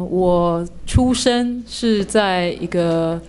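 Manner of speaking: ordinary talk, nothing marked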